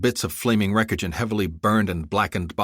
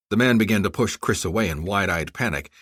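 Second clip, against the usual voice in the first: ordinary delivery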